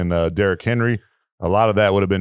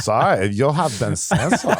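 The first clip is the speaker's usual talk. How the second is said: slow, hammed-up German accent; 3 semitones higher, slower than usual. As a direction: announce it in a mock German accent, drawn out. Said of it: "in a German accent".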